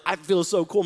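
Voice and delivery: loud, gleeful boast; excited. Retold or imitated. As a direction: show off like a teen in a fast car. Retold thrill in car.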